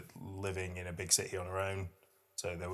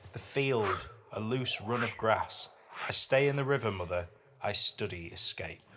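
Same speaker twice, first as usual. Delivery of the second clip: higher, louder, measured poem recital; poetry reading; 3 semitones higher, 3 dB louder than usual. Acted. Podcast host reads a dark fairy-tale poem to her co-host, recited with weight. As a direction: read it like a performed poem, each image weighed.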